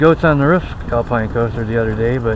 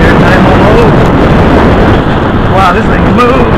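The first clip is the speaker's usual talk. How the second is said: loud, raised voice over rushing air; shouting over the wind; 6 semitones higher, 9 dB louder than usual. Real, not acted. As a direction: call out over the noise of the ride.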